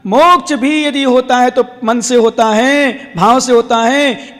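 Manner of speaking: slow, stretched preaching cadence; emphatic sermon delivery. Real, not acted. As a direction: drive the teaching home with drawn-out endings. Preaches at full force.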